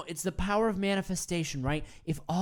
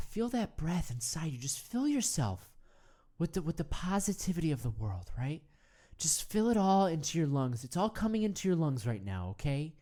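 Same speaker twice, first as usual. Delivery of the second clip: guided meditation; 3 semitones lower, 3 dB quieter than usual. Acted. Leads a breathing meditation.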